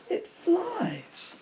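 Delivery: breathless awestruck whisper; awed stammer. A narrator's reading. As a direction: gasp it out in wonder, barely voiced. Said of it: "Pete stammered".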